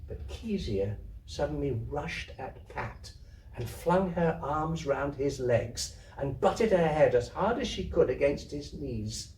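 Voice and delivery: ordinary delivery, nothing marked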